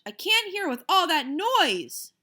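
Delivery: loud, high and a bit slow; big brother yelling over banging pans. Acted. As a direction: shout over the din. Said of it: "I yelled at Fudge".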